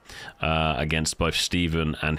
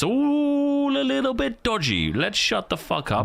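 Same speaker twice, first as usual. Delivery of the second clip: much higher mock voice; comic falsetto aside; high silly voice. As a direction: squeak it out for a laugh. High put-on voice.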